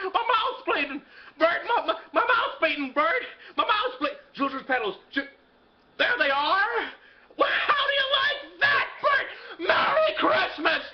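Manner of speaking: very high, laughing excitement; hammed-up giddy elation, rising to shouts; ecstatic joy. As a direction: whoop with disbelieving delight. George overjoyed to be alive.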